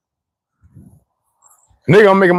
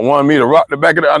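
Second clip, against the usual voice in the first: ordinary delivery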